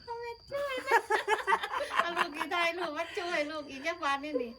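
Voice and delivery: high, loud, through laughter; laughing. Real, not acted. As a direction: laugh the words out. Laughing over secret numbers.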